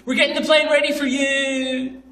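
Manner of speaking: very high, held-out announcer call; hammed-up plane announcement, last word stretched; imitating the awkward announcer. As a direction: mimic an announcer stuck on a high note. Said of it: "he would say it like this".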